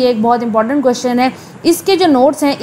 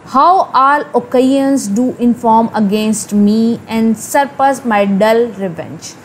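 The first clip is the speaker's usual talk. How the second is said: slow, lower-pitched reading of verse; text recitation; 3 semitones lower, much slower than usual. Acted. Teacher reading Hamlet's soliloquy to students, slow low recitation. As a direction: recite it slowly and deliberately, word by word. Reads the original soliloquy aloud.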